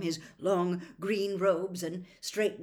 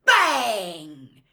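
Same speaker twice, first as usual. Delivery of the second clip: very loud, drawn-out falling "bang"; sudden booming burst; explosive exclamation; 13 dB louder than usual. A narrator's reading. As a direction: shout it like a blast. Narrator performs the explosion.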